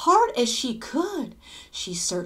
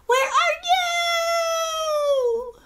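long high drawn-out call; girl shouting for her lost monster, long and high; loudest shout; 4 dB louder, much slower than usual. A narrator's reading. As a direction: call across the field at full voice. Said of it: "shouted in her loudest, loudest voice".

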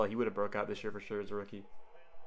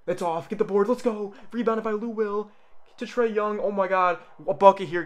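high, loud hype; excited shouting; 10 semitones higher, 8 dB louder than usual. Real, not acted. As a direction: yell the play like a fan. Live reaction, rebound and drive.

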